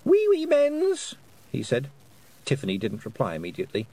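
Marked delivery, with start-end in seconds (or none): 0.1-1.2 put-on very high, slow, louder toddler cry; delighted small child. A narrator's reading. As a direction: draw it out in childish glee. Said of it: "Wentworth brightened up".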